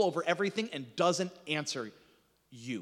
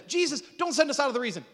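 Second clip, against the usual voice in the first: pleading demons; 8 semitones higher, 5 dB louder, faster than usual. Acted. Preacher voicing pleading demons.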